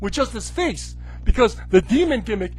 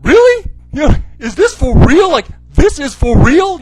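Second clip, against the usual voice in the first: loud, much higher disbelief; incredulous; 5 semitones higher, 9 dB louder than usual. Retold or imitated. Vlogger replies to the promo, loud incredulous disbelief. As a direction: act stunned in disbelief. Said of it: "and I'm like".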